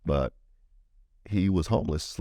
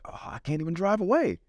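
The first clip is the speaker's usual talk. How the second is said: despairing cry; 13 semitones higher than usual. Retold or imitated. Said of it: "He's like".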